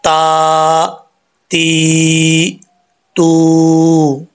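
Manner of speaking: ता, ती, तू each long and sustained; drawn-out Quranic syllables. Shown as a demonstration. Teacher demonstrating the vowel sounds to students, long sustained recitation. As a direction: chant ता ती तू slowly with long vowels. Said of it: "sound क्या आएगा अब इसका?".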